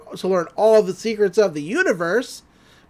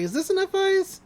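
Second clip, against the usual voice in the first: high squeaky voice; 11 semitones higher than usual. Retold or imitated. Candy's puppet question, high voice.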